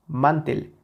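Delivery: "mantel" stressed on "man", weak "tel"; sin fuerza en la última. Shown as a demonstration. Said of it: "Si le quitamos esa intensidad". ("mantel" con el golpe en "man")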